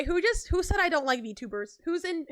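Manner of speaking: normal talking voice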